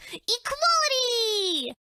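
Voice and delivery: high, slow drawn-out cheer; mock triumphant cheer. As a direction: proclaim it like a victory slogan. Character cheering a declaration.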